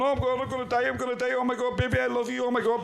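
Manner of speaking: high frantic pleading; imitating terrified pleading; mock panic. Stoned man scrolling daughter's pictures, high mock panic.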